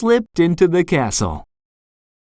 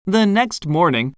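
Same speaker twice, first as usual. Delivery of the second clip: plain reading voice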